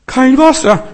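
high-pitched mock complaint; imitated outcry. Israelites crying to the Lord for water, in high-pitched complaint. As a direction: cry it out high, without full volume. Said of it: "schrien sie zum Herrn".

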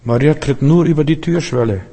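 usual speaking voice

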